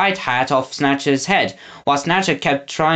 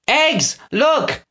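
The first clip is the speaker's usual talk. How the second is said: high calling out; excited cry; 10 semitones higher than usual. A narrator's reading. Winnie to Eggs at the truck, excited cry. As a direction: point it out with a cry. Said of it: "Winnie cried".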